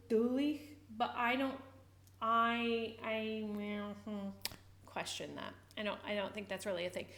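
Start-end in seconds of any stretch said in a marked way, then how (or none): none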